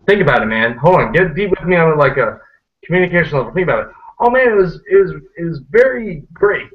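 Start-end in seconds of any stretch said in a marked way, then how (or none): 4.2-6.7 mock gushing. Shown as a demonstration. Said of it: "You don't need the word very".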